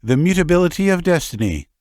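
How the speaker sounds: put-on high, fast sing-song boy's chant; shrill sing-song. Tartar waiter boy answering, shrill and sing-song. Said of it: "in a shrill voice with a sing-song intonation".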